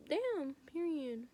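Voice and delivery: sassy verdict. Retold or imitated. Triumphant verdict after teacher backs off.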